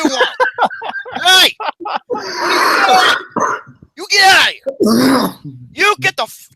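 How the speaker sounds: loud, high, furious yelling at an intruder; angry shouting. Acted. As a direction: yell him out of the room.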